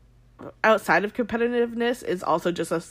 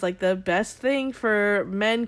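ordinary talk

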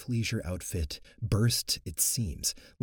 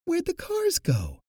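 much higher, slow panicked cry; panicked question; 4 dB louder than usual. Acted. Kiwi asking the coven about the vanished cars, panicked. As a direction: ask in rising panic. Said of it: "Kiwi questioned in a panic".

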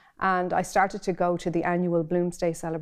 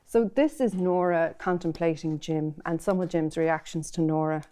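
ordinary talk

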